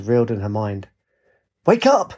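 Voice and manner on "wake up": high, sudden shout from below. A narrator's reading. A voice below waking the dozing friends, shouting. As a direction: shout to rouse sleepers. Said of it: "a voice shouted below".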